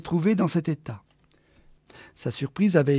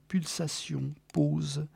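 much softer, hushed word; 7 dB quieter, much slower than usual. A narrator's reading. Narrator evoking the lone heartbeat, hushed. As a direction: breathe it like a heartbeat.

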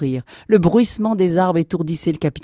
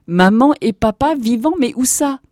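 loud, high incredulous question; astonished outburst; 8 semitones higher, 7 dB louder than usual. Acted. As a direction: blurt it out in amazed joy. Captain learns parents are alive.

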